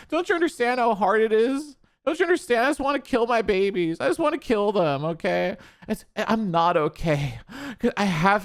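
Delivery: high, pleading parody of a distraught mom; mock crying. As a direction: whine it as a faked breakdown. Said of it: "Time to pretend to fucking cry".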